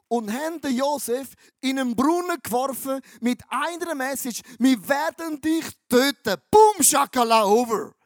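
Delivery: loud, high dramatic telling; dramatic shout. Acted. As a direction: build to a shout on the brothers' threat. Brüder werfen Josef in Brunnen. (laut und hoch herausgerufen)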